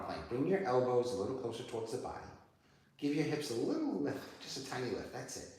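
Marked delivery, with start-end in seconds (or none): none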